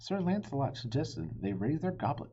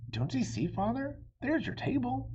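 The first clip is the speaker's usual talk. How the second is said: excited; 12 semitones higher than usual. A narrator's reading. Boy excited to show his father.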